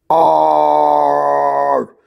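high drawn-out Arr cry; hammed-up cry; high pirate shout. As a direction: bellow out a long high Arr. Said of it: "Obama suddenly shouted".

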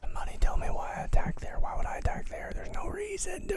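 loud, forced whisper; frustrated stage whisper; strained whisper. Real, not acted. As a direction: hiss it in disbelief. Frustrated at own attack.

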